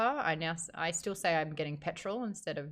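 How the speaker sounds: ordinary talk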